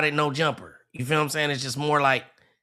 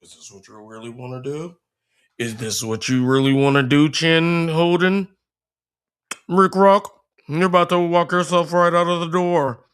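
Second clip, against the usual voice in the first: imitating Lush building from a hush to a loud threat; mock menacing Lush; 5 dB louder than usual.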